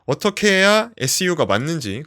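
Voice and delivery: ordinary talk, nothing marked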